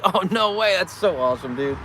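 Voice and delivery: bright, loud delighted reaction; thrilled to meet a fan; excited. Retold or imitated. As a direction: gush with surprise at meeting a fan. Excited reaction to meeting viewer.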